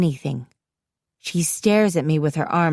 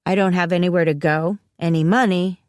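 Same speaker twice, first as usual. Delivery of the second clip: ordinary delivery, nothing marked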